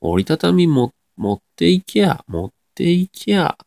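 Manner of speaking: high, slow demonstration of the rise in 持っていきゃ; Nagoya pitch rise. Shown as a demonstration. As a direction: stretch 持っていきゃ, lifting the pitch within it. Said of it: "三つ目がやっぱ上がりますね". (「持っていきゃ」を高く上げて伸ばす)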